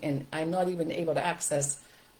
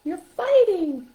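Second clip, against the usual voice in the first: very high, slow puppet voice; high-pitched, drawn-out animal character; stuffed animal voice; 13 semitones higher, 6 dB louder, slower than usual. Shown as a demonstration. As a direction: talk as a concerned toy animal, pitched way up. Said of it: "You're changing your voice a little bit".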